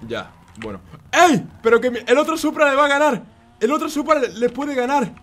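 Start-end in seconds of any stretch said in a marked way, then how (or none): 1.1-5.1 high, urgent exclaiming; alarmed outburst. Real, not acted. Player watching the other Supra win, alarmed high outburst. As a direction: cry out as the lead slips away.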